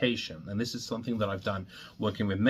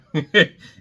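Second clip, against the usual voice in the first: sheepish laugh; 4 semitones higher, 9 dB louder than usual. Real, not acted. Laughs at own word slip.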